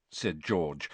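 usual speaking voice

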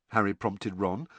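usual speaking voice

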